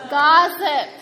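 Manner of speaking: loud call-out of the answer; shouted word. Real, not acted. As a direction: shout it to the whole room. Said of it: "stand up and shout this word out".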